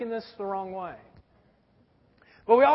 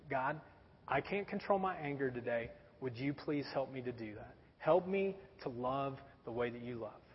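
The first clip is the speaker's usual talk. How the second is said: low, hushed plea to God; humble prayer; 8 semitones lower, 12 dB quieter than usual. Retold or imitated. Man praying each morning for help with anger, soft and low. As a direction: pray softly, surrendering. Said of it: "I pray".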